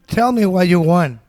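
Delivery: loud call across the room to customers. Retold or imitated. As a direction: raise your voice to be heard by the diners. Calling out to customers over noise.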